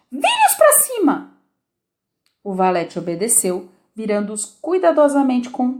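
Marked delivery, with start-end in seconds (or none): 0.1-1.4 much higher, slow angry command; angry order. A narrator's reading. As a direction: bark it at the knave, annoyed. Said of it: "ordenou ao valete". (ordem zangada, bem aguda e lenta)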